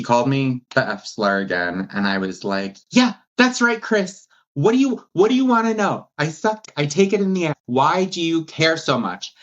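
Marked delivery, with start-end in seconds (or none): 2.9-9.3 high, mock-defiant retort to a bully; sarcastic high-pitched clapback; sassy comeback. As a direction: toss it back with sass, pitched way up.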